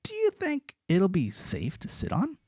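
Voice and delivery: put-on high, slow, wary girl voice; a girl uneasy about the seesaw; older girl doubting the seesaw; doubtful older girl. A narrator's reading. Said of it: "a girl who was older than the others said".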